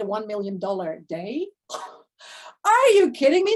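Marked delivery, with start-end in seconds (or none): 2.6-3.5 high, loud burst of disbelief; real excitement. Real, not acted. Coach thrilled by her client's million dollar day, loud and high. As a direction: blurt it out thrilled.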